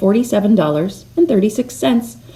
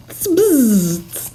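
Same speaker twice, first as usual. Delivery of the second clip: bird bzz bzz; 4 semitones higher than usual. A narrator's reading. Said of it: "said the flugelbird".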